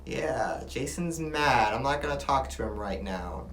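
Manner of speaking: quiet private reaction; inner thought. Shown as a demonstration. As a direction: murmur it like a thought. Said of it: "It almost sounds like".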